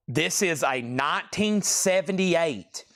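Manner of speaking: normal talking voice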